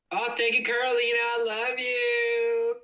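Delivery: very high, slow and drawn out; high sing-song. Real, not acted. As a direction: stretch it sweetly up high. Sweet thanks to Carolina.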